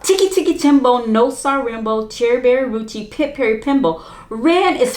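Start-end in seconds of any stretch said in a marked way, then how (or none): none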